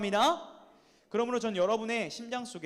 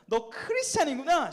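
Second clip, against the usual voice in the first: mocking tone; 6 semitones higher, 5 dB louder, slower than usual. Shown as a demonstration. Preacher modelling how early believers were mocked, jeering. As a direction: say it slowly as a taunt, pitched up. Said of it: "이런 말투로 말하세요".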